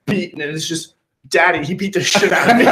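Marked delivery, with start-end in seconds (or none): 1.3-2.6 higher-pitched daughter voice breaking into laughter; mock victim. Friend imagining her Snapchat to Daddy, mock victim. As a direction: play a hammed-up complaint to Daddy, laughing.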